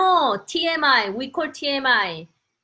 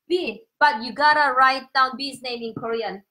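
ordinary delivery

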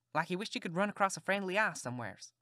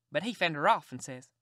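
ordinary delivery

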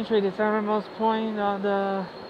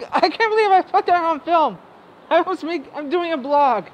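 flustered exclamation; 8 semitones higher, 8 dB louder than usual. Real, not acted. Embarrassed after shouting on film.

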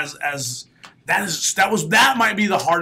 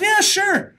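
eager; 3 dB louder than usual. Retold or imitated. Accepting ring announcer job.

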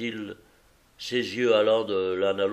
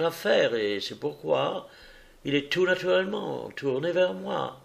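higher, polished detective voice; Holmes, smoothing things over; 6 semitones higher than usual. Acted. Holmes calming the offended visitor, higher and smooth. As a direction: placate him gently but surely.

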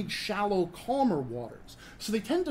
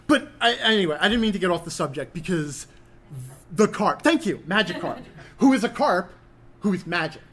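ordinary delivery

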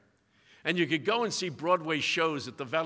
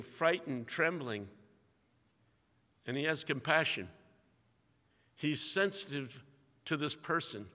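softer, hushed compassionate narration; tender, quiet telling; 2 semitones lower, 7 dB quieter than usual. A narrator's reading. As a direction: draw listeners close to the weeping woman.

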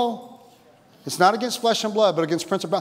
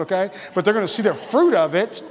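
usual speaking voice

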